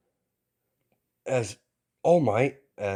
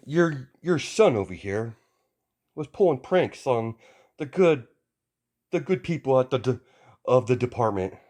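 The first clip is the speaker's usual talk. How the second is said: higher, louder heroic scolding; a booming hero lecturing a kid; stern hero voice; 5 semitones higher, 6 dB louder than usual. Acted. All Might scolds young Izuku, loud and high.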